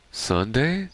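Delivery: high, questioning cartoon lilt; SpongeBob. Fan retelling the sponge realizing it's Sunday, light SpongeBob voice. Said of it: "on Sponge Day, he says".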